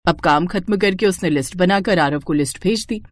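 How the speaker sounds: ordinary delivery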